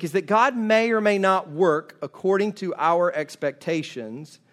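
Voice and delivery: ordinary delivery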